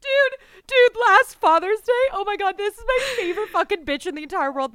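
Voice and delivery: high, very loud laughing scream; screaming with laughter; laugh-crying shriek. Real, not acted.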